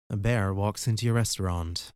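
plain reading voice

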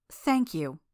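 mildly impatient, barely grateful; impatient thanks. A narrator's reading. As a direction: give grudging thanks with a touch of impatience. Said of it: "more impatient than grateful".

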